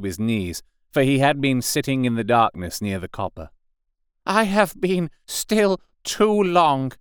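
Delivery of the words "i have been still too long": high, slow, ringing declaration; carrying, defiant tone. A narrator's reading. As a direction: proclaim it so it carries far. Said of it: "in a tone that must have reached the pit".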